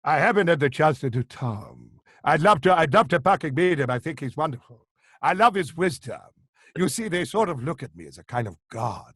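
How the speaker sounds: grand actor's voice with punched words; Tom Baker. Acted. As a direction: impersonate a theatrical star holding forth. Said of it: "have you done yours to Tom Baker".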